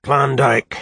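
loud, high lofty drawl; superior and bored. A narrator's reading. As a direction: toss it off like it's nothing. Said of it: "said the superior, bored, whiskey voice".

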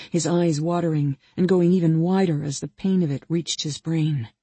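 plain reading voice

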